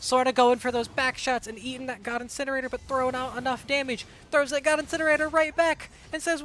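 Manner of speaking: ordinary delivery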